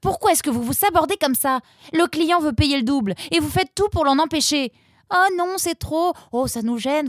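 high, loud furious daughter; angry outburst. A narrator's reading. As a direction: let the anger explode. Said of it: "Roxane laissa éclater sa colère". (Roxane explose de colère, aiguë et forte)

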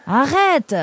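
high, indignant outburst; annoyed. Acted. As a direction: cut off the teasing. Nemrod taquiné par Mazen. (agacé, voix qui monte)

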